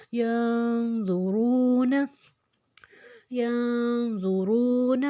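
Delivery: slow ینظرون, long nasal n then a thick, heavy z; heavy z, ghunna. Shown as a demonstration. Teacher reminds learners of the thick letter, heavy z in ینظرون. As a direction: keep the z full and heavy in ینظرون after humming the n. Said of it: "زوئیں کو موٹا ہی رکھنا ہے".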